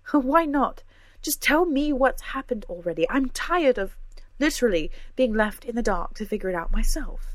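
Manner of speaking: fed up, demand the truth; frustrated outburst. A narrator's reading. Cora demands answers from her friends, loud and frustrated.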